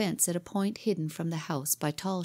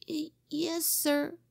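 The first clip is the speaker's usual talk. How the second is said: timid stammer; 10 semitones higher, slower than usual. Acted. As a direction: stammer it out, caught and afraid. Scared boy stammers.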